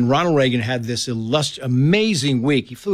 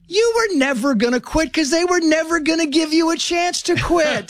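much higher, amused mother letting him in on it; knowing mom; 13 semitones higher than usual. Retold or imitated. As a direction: play the mom who saw it all along. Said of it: "My mom's like".

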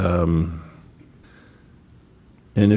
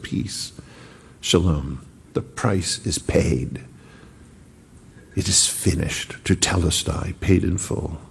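soft, slow, hushed voice; hushed blessing; 4 semitones lower, 6 dB quieter than usual. Acted. Jesus shows the disciples his hands, hushed and soft. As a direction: speak peace softly, reverent and low.

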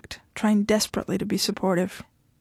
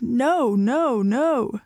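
shouting; 3 semitones higher, much slower than usual. A narrator's reading. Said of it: "he shouted".

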